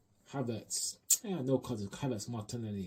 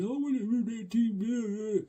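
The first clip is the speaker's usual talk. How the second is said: mocking whiny fan; 11 semitones higher, 7 dB louder than usual. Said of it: "All your crying fans saying".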